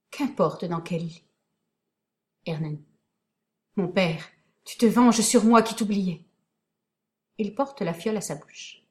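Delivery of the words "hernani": plain reading voice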